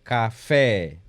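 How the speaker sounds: "café" with the "fé" punched loud; stressed tonic syllable. Shown as a demonstration. Music teacher illustrating the tonic syllable, exaggerated stress on it. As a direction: lean hard on the last syllable of "café". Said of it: "seja mais forte do que as outras".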